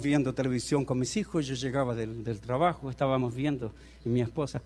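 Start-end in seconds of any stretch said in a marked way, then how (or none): none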